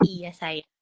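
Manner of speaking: put-on a boy sweetly soothing his mom; sweet-talking. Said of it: "sekarang yaitu agak ngerayu". (merayu dengan suara anak yang lebih lembut)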